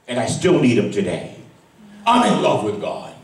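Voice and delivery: usual speaking voice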